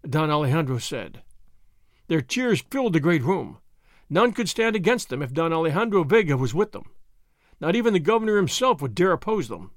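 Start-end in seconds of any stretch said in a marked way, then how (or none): none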